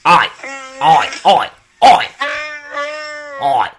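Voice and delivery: loud, sharp scolding shout; shouting at the Wookiee. Acted. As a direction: yell at an unruly pet to sit down. Yelling at the Wookiee.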